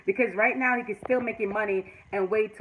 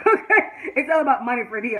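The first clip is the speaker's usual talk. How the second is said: laughing; 2 semitones higher, 6 dB louder than usual. Real, not acted. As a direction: burst out laughing through it. Laughing at own greed.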